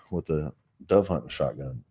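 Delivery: usual speaking voice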